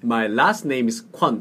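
slow, clear English for repeating; teacher's unhurried model; slow model reading. Shown as a demonstration. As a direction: say it slowly for a learner to copy. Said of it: "이렇게 해볼까요".

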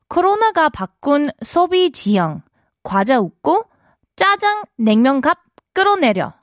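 reading Korean headline. Shown as a demonstration. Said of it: "this here says".

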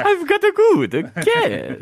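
high, slow, loud vampire voice; Dracula voice. Acted. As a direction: go big on the vampire. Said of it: "that's a good Dracula impression".

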